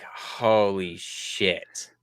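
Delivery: very slow, hushed and low; retold sinking realization, drawn out softly; stunned dismay. Dad realizing the riders are English, stunned dismay.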